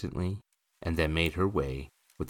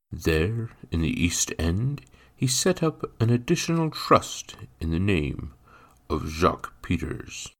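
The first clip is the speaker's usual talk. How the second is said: measured formal character voice, a little louder; lightly voiced formal gentleman; official-sounding gentleman; 5 dB louder than usual. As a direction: announce it as a man of business.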